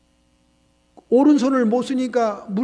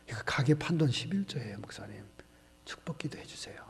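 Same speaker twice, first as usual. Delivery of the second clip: hushed whisper; 7 semitones lower, 10 dB quieter than usual. Retold or imitated. Sister hands tithe envelope.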